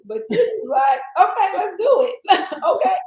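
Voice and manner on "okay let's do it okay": high, loud, laughing go-ahead; game and giggly, pitched up; laughing agreement. Retold or imitated. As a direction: agree eagerly through a laugh. Acts out willing reply, laughing.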